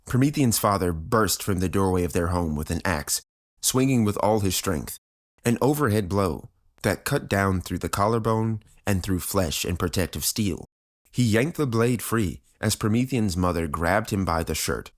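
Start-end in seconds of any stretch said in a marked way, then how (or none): none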